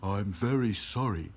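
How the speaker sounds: put-on doleful doll voice; glum Sad Sack. A narrator's reading. Sad Sack apologising to the princess dolls, glum.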